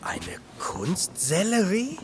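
puzzled slow whisper. Acted. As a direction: whisper the question, confused. Whispering in gallery.

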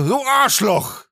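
high, drawn-out mock yell; retold shout. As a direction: yell the insult as if riding away. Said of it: "schreit er so".

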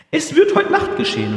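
loud rough voice. Retold or imitated. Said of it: "sagt sie in einer lauten, rüden Stimme".

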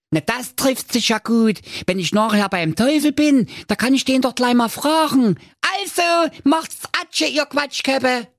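put-on high cheeky young lad; Schnitzelschorsch voice. A narrator's reading. Narrator voicing Schnitzelschorsch, a cheeky high voice. Schorsch replies.

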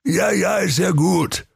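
put-on high, weary old voice; old Santa. Santa giving in to the brothers, high weary. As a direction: brush it off, high and tired. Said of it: "sprach der alte Waldemar".

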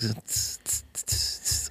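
whispered hi-hat ticks; tsk drum beat. Shown as a demonstration. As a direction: tick like a drum machine. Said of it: "ein bisschen so Schlagzeug". (geflüsterte Schlagzeug-Tss-Laute)

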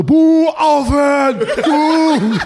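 imitating an outraged audience; mock crowd booing. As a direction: boo like the whole hall. Whole crowd booing the show.

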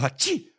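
imitated high sneeze; sneezing.

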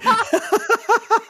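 high squealing laugh; helpless giggling fit; burst of laughter. Real, not acted. Laughing at own mistake. (hohes, prustendes Lachen)

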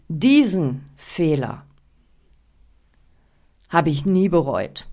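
DIESEN drawn out slowly, long pause after Fehler; stress on DIESEN. Shown as a demonstration. Coach gives an example mystery opener, stress on DIESEN. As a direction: dwell on DIESEN, then hold back the rest. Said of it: "die Betonung auf das Wort das gelegt".

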